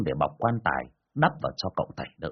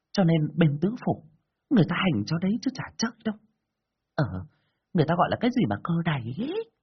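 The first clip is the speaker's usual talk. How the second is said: high-pitched village gossip; disbelieving villager; 8 semitones higher than usual. A narrator's reading. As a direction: scoff and gossip in a raised, put-on voice. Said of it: "Có người khác lại không tin".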